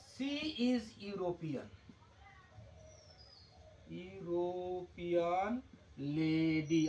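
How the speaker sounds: very slow, word by word; slow dictation. Shown as a demonstration. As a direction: dictate it slowly for the board. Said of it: "अगर यहाँ पर यदि full stop है". (धीमे-धीमे बोलकर लिखवाना)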